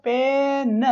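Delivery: "पेन" stretched, final न set apart; teacher breaking "पेन" into its sounds; sounding out. Shown as a demonstration. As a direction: draw out the vowel in "पेन", then land on न. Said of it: "और न का symbol क्या होता है".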